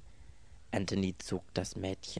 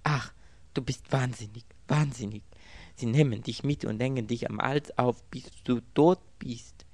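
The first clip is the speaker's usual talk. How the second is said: higher, louder, agitated voice; agitated warning; 4 semitones higher, 5 dB louder than usual. A narrator's reading. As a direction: warn him urgently, acting distraught. Mädchen warnt ihn verzweifelt. (aufgeregt warnend)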